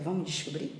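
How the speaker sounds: usual speaking voice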